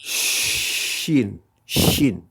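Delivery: "Shin" with a long hushing sh; "Shin", ch as in French chat; "Shin" comme le che; Arabic letter Shin. Shown as a demonstration. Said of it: "c'est le che".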